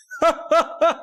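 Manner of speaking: short burst of high-pitched laughter; real laugh, high and quick; high amused laugh. Real, not acted. Streamer laughing at a viewer's joke comment, amused.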